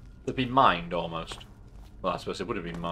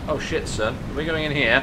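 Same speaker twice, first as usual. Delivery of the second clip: louder, higher startled outburst; startled excitement; 5 semitones higher, 8 dB louder than usual. Real, not acted. Player dropping into the hole, loud startled outburst. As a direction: shout it as the floor gives way.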